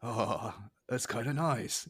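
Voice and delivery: a quiet, lightly voiced Snake; a hint of Snake's voice; Snake impression. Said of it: "my Snake impression".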